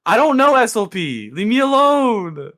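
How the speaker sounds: high, loud wail of protest; exasperated outburst. Real, not acted. Stumped player snaps at teammate during timed rant, exasperated yell. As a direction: yell to be left alone, dragging out the last words. Put on the spot, lashing out.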